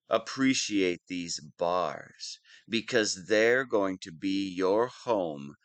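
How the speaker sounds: ordinary talk, nothing marked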